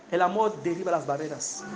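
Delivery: a quiet echo of the proclamation; soft echo. Acted. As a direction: let it sink in, almost hushed. Repeated line, now quietly.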